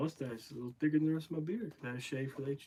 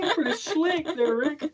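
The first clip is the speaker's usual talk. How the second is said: laughing; 13 semitones higher, 12 dB louder than usual. Real, not acted. Laughing at a clever phrase.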